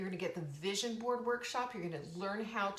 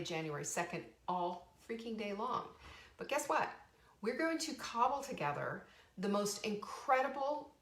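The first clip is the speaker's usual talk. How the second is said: ordinary talk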